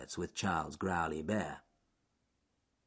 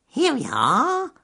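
much higher, slow squeaky weasel; squeaked; 8 dB louder, slower than usual. Acted. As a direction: squeak it like a sly little weasel. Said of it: "squeaked Wily Weasel".

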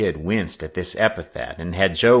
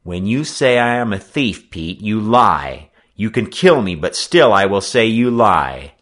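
firm, louder voice, held in control; calm, deadly earnest; 3 semitones higher, 6 dB louder than usual. A narrator's reading. Boy facing a second blow, calm and deadly earnest. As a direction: state it steadily and mean every word. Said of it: "he was calm and in deadly earnest".

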